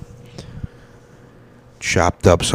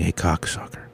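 whispered, much softer; inner thought; 8 dB quieter than usual. Retold or imitated. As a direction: breathe the words as if only in your head. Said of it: "in my head, I'm like".

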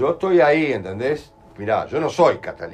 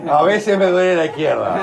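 loud, high call over laughter; real loud interjection over the crowd; 7 semitones higher, 8 dB louder than usual. Real, not acted. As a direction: raise your voice above the chatter. Calling out over laughter and crosstalk.

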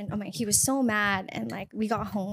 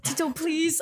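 mock begging; 7 semitones higher, slower than usual. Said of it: "You're not supposed to start begging".

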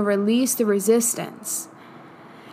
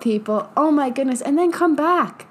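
ordinary talk

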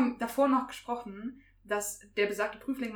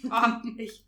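ordinary talk

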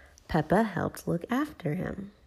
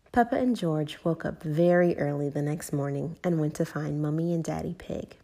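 usual speaking voice

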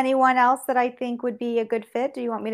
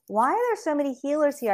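mild, higher-pitched retold bafflement; bewildered; 6 semitones higher than usual. Newcomer wondering at all the healers here, mildly bewildered. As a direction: lightly act out your own puzzlement.

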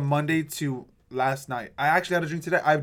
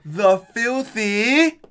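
announcer voice; 7 semitones higher, 9 dB louder, much slower than usual. Acted. Announcing the show's name.

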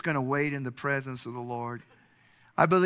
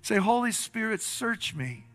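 raised-pitch, pleading prayer leading; fervent prayer; 6 semitones higher, 5 dB louder than usual. Real, not acted. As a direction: lead the congregation phrase by phrase. Leads congregation in prayer.

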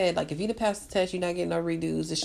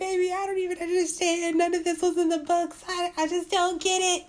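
high, pleading, near-tearful complaint; upset and whiny; 10 semitones higher than usual. Retold or imitated. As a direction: whine it like you're about to cry. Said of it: "I'm always crying, and I'm always upset".

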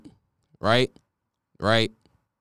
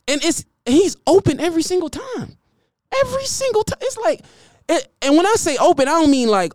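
much higher, squeaky, incredulous; excited disbelief. Real, not acted. Raving about Tariq always open, high excited squeak. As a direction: voice cracks up high in amazement.

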